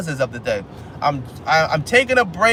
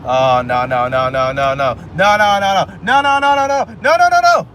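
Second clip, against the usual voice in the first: narastająca panika; 4 dB louder than usual. Real, not acted. Panics over nearly missed stop.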